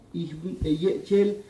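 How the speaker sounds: ordinary talk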